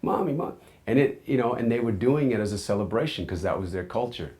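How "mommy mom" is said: put-on scared child. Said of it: "I was like".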